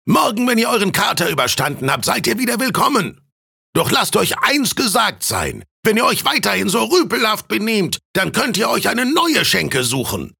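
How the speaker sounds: high-pitched scolding landlord; roaring innkeeper. A narrator's reading. Innkeeper warning rowdy drunken students, high scolding bellow. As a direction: call out a stern warning, pitched well up. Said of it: "brüllte der Wirt".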